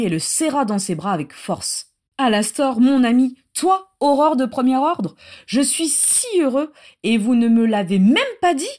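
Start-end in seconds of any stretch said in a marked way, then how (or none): 2.2-8.7 loud, raised, delighted greeting; elated, emphatic and louder; overjoyed outburst. A narrator's reading. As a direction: burst out with joy at an old friend. Adam embraces his friend unrestrained.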